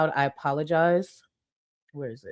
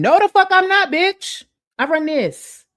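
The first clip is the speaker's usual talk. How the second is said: much higher and louder, sassy and defiant; mock bossy diva; 12 semitones higher, 7 dB louder than usual. Streamer parodying a community queen, loud high diva. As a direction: bark it like a self-crowned queen.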